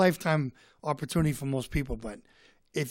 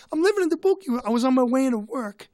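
high, louder imitation of an excited man; excited local; 10 semitones higher, 7 dB louder, faster than usual. Tour guide tells the comedian he's local, imitated high and excited. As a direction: act out his eager reply, pitched way up.

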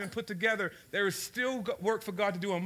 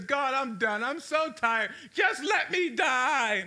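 despairing plea; 5 semitones higher, 4 dB louder, much slower than usual. Acted. Elijah giving up under bush.

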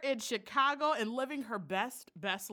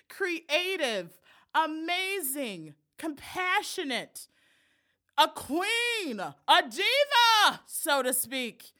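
slow, drawn-out announcer build-up, a bit louder; hype introduction; 4 semitones higher, 7 dB louder, slower than usual. Acted. Host building up the guest's entrance, announcer style. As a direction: stretch each title like a ring announcer.

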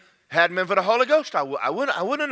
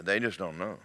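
pitying aside; 9 semitones lower, 7 dB quieter than usual. Acted. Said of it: "we go".

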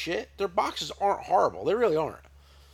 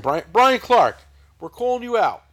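loud, higher, playful challenge; calling out; 6 semitones higher, 11 dB louder than usual. Real, not acted. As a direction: shout his name like a challenge. Calling out a guest on air.

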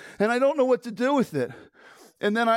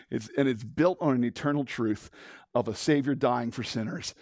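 normal talking voice

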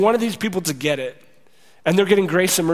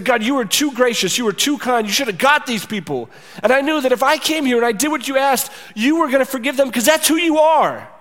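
angry Jonah; 7 semitones higher than usual. Acted. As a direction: throw God's kindness back as an accusation. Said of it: "It's like".

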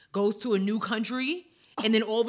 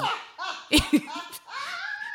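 high burst of laughter. Real, not acted. Big laugh.

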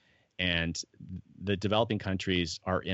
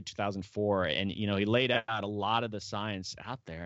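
ordinary talk, nothing marked

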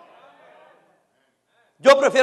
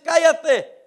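God's rebuke; 6 semitones higher than usual. Acted. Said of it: "Y Dios dice".

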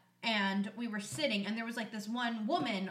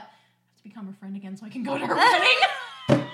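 laughing confession; 11 dB louder than usual. Retold or imitated. Friend confessing her old plan to reconnect, breaking into laughter. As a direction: crack up towards the last word.

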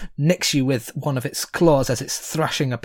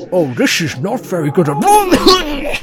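a lightly voiced dragon, mock-dismayed; dragon worried; 6 semitones higher, 5 dB louder than usual. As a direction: say it like a dragon realising trouble, a touch louder. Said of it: "you hear the dragon go".

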